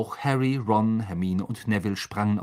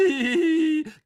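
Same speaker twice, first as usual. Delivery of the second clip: shrill mocking giggle of a ghost; high poltergeist cackle; 4 dB louder than usual. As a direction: cackle gleefully. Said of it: "gackerte Peeves". (hohes gackerndes Kichern)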